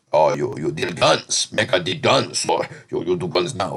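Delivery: a hint of Jamaican patois, retold from the show; imitated Jamaican accent. Recapping the Connect's man, a light Jamaican accent. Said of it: "the guy with, like, the Jamaican accent".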